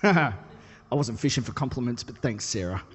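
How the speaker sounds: soft laughing aside to the crowd; laughing aside to Sarah in the congregation. Real, not acted. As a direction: chuckle it off quietly to Sarah.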